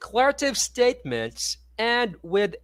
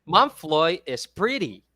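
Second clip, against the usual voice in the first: slow statement, "pretty" falling. Shown as a demonstration. Tutor showing a statement that ends falling. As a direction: drop the voice on "pretty". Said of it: "So we end with a falling tone".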